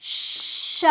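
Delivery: श with a long, pushed sh hiss; forceful श. Shown as a demonstration. Teacher showing which श needs force, long hissed sh. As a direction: push out a long sh before the vowel in श. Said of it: "इसको थोड़ा जोर दे के बोलना है".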